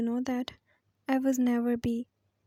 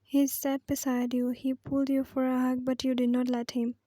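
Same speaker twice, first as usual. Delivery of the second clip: usual speaking voice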